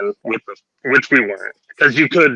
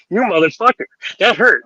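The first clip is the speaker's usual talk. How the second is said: higher, whiny wife after the shot; mock hurt complaint; 6 semitones higher than usual. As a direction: whine it like you just got stung.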